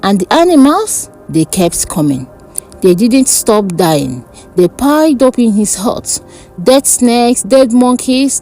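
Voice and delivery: plain reading voice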